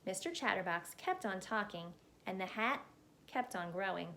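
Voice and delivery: usual speaking voice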